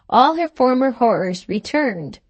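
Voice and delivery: plain reading voice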